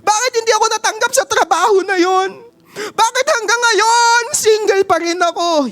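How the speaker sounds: hammed-up griping believer; mock complainer. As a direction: believer whining to God after rejection, high and fast. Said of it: "napapatanong ka".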